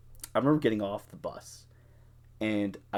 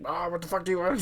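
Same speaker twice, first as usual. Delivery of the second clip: higher, hammed-up shouting roommate; mock yell; 8 semitones higher, faster than usual. Roommate yelling at the cab driver, a retold mock yell. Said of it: "Just fucking screaming at him".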